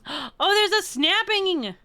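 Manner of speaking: high, slow, delighted cry; light up at the sound; delighted exclaim. Real, not acted. Delighted at hearing snaps.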